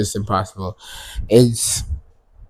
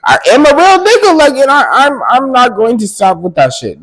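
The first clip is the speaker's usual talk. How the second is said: much higher, louder, pushing back hard; heated high defiance; 9 dB louder than usual. Real, not acted. Vlogger firing back at a dude who mocked her, heated and high. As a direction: fire back at him, voice shooting up.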